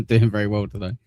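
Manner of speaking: usual speaking voice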